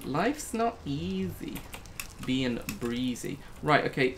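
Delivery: ordinary talk, nothing marked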